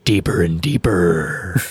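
low eerie whisper; spooky whisper. Pretend. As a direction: breathe it like a horror trailer. Echoes tweet in spooky voice.

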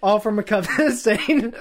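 loud, high, slowed by laughter; cracking up. Real, not acted. Reader trying to get through the scripture, cracking up. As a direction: try to read on through the giggles.

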